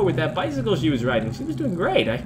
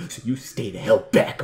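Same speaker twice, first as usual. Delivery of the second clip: ordinary delivery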